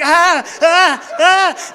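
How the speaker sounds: high, loud comic screams; screaming. Retold or imitated. Air blasts in her eyes, screaming high and loud. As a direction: yelp at each air blast. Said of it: "I'm, like, screaming".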